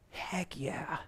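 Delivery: whispered yes. Acted. Eager believer answers God.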